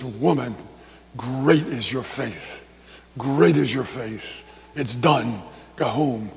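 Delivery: low, slow, softened voice; hushed, unhurried blessing, acted; tender reassurance. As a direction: voice Jesus gently affirming her. Jesus tenderly grants woman's plea.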